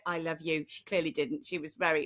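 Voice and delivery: normal talking voice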